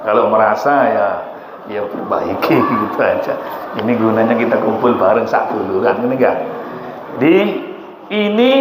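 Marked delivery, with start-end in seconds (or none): none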